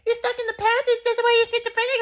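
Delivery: very high mock aunt voice with a laugh; high falsetto aunt, laughing as she teases; laughing aunt impression. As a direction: mimic the aunt high, giggling. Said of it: "And my aunt was like".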